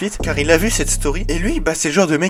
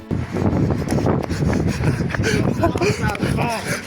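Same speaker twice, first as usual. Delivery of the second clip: high laugh. Real, not acted. Big laugh on the footage.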